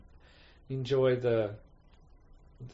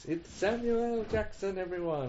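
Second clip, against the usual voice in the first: higher-pitched, playful big reveal; mock announcement; 7 semitones higher than usual. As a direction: present a star to the crowd, lightly hammed-up. Voicing an imagined big banner.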